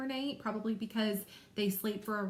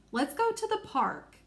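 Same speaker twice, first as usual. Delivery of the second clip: higher, eager storybook character voice; Kendra; 4 semitones higher than usual. Shown as a demonstration. Teacher showing pupils how Kendra would sound, high and eager. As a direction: lift the pitch like an excited character suggesting an outing. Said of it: "sound like I think that Layla and Kendra would sound".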